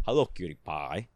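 Arnold Schwarzenegger. Shown as a demonstration. Showing the closest they can get, rough Austrian accent. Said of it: "sounding like Arnold I can get".

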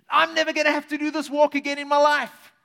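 relieved joy at skipping the walk; excited woman. Retold or imitated. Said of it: "she's going".

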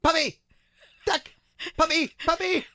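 put-on high, loud toddler cries, one word at a time; screaming toddler. Parent imitating their two-year-old calling for lost stuffies, mock scream. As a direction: mimic a small child yelling out in the night. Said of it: "you'll typically hear him scream".

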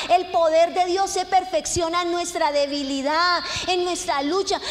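emphatic pulpit proclamation; preaching at full force. Real, not acted. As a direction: drive each phrase home to the congregation.

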